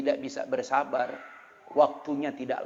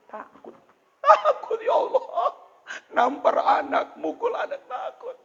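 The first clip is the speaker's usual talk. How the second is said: crying out; 6 dB louder than usual. Real, not acted. Fearful emotional confession.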